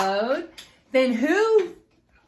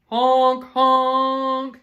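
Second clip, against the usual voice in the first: truck honking; 6 dB louder than usual. Acted. Dump truck honking in story.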